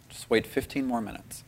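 very soft, hushed nudge; gentle whisper. Retold or imitated. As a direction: imitate a gentle voice, barely audible. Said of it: "just whispered to me".

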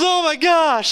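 very high, mock-overwhelmed exclamation; overwhelmed astonishment. Friend realising they understood nothing, overwhelmed astonishment. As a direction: imitate a stunned friend, pitch way up.

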